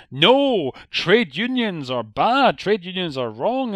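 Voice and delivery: high, loud, slow bossy manager voice; ranting manager. Retold or imitated. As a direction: lecture him like a pompous boss. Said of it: "And the guy says".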